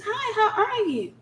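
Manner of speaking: high, slow sugary greeting; high sugary hello; sweet girlfriend. Retold or imitated. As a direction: play the sweet new fiancee.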